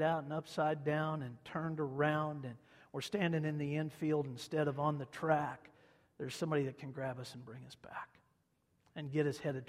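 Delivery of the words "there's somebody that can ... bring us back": unvoiced hushed sermon whisper. Real, not acted. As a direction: drop to a whisper for the comfort.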